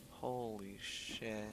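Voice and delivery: stunned whisper. Real, not acted. Stunned reaction, slow and soft.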